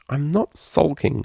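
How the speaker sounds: slow, muffled denial; muffled into a pillow. Acted. Octavia protests from the pillow, slow and muffled. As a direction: speak into the pillow. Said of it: "said her muffled voice".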